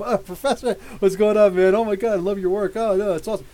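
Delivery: imitating a starstruck fan, loud in bursts; lightly voiced excited fan; gushing fan. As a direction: gush like an admirer meeting their hero. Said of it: "Every time somebody's like".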